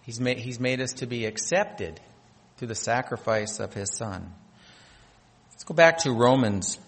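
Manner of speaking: ordinary talk, nothing marked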